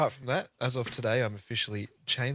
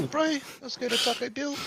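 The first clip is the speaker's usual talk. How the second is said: much higher hammed-up Kiwi bro; Kiwi accent; 13 semitones higher than usual. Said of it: "saying Taco Bell with a Kiwi accent".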